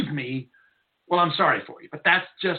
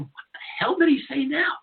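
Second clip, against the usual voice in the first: higher, exasperated disbelief; incredulous; 9 semitones higher than usual. Retold or imitated. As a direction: throw up your hands in disbelief. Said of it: "And I'm going".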